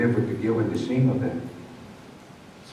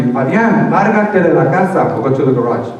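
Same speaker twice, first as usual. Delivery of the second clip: mom's order; 4 semitones higher, 6 dB louder than usual. Retold or imitated. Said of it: "she told me".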